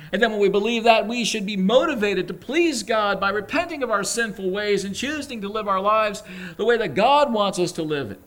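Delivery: loud, high, fervent altar call; preaching at full force. Acted. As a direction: press the call on the listeners.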